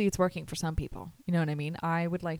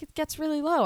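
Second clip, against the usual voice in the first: high-pitched voice for the patient; imitated patient; 8 semitones higher than usual. Patient's reply voiced in story.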